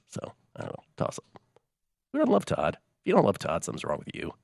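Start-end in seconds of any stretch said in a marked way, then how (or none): 0.1-1.4 under the breath, unvoiced shrug; mumbled aside. Real, not acted. Host shrugging off a question to cohost, whispered aside. 2.1-2.7 don't jumps up high, incredulous; mock outrage. Real, not acted. Host defending Todd to listeners, high mock outrage.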